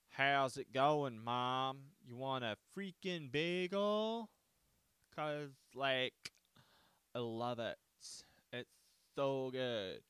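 loud, hammed-up mash of American regional accents; slow, brash parody of American accents, building to a yell; mock American accents. British traveler back home to Mom, parodying American accents. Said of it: "come back to England and be like".

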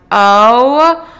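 loud, rising drawn-out "oh"; indignant surprise. Retold or imitated. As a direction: let a long oh climb in disbelief. Finds him idle on the turf.

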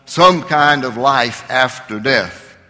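ordinary talk

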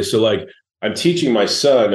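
normal talking voice

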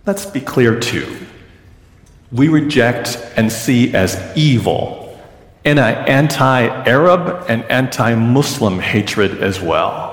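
ordinary talk, nothing marked

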